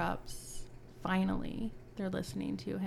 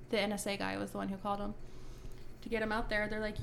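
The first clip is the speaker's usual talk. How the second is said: ordinary talk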